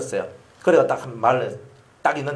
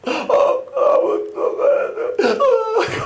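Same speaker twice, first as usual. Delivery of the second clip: put-on high, slow, choking child voice; a frightened child who can't breathe; gasping child; 3 dB louder, much slower than usual. Voicing struggling child.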